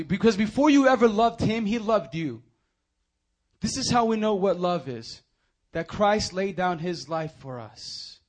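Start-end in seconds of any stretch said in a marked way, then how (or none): 0.0-5.2 louder, higher proclamation; raised, fervent preacher voice; preaching emphasis. Acted. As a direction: drive the truth home to the congregation. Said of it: "repeated line".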